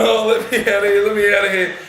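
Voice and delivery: hammed-up panic to get out of the van; mock panic. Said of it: "we're just going".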